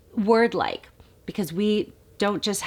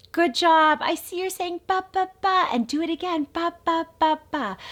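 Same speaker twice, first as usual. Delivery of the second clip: put-on high, bright praise in baby talk; excited parent praising a baby's babbling; encouraging praise; 10 semitones higher than usual. Shown as a demonstration. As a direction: reward the baby's try with delight. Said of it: "Good, encourage that".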